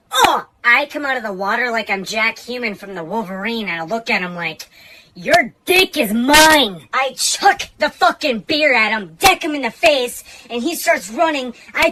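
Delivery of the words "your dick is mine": loud, slow, higher menacing snarl; menacing growl. Retold or imitated. After surfacing from the river, a slow loud snarl. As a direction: growl it like a movie hero's vow of revenge. Coming out of water like Wolverine.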